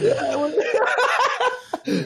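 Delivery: high, loose burst of laughter; cracking up. Real, not acted. Laughing at acted radio exchange.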